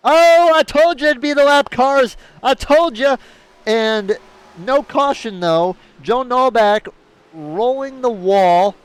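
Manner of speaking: loud, high excited call; excited shout. Real, not acted. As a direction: yell it out like a thrilled commentator. Commentator calls the wreck live.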